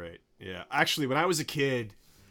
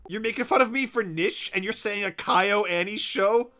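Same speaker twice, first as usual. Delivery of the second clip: high, loud incredulous ribbing; teasing mock outrage; 8 semitones higher, 5 dB louder than usual. Real, not acted. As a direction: needle a friend with rising disbelief. Mock-scolding friend for mispronouncing.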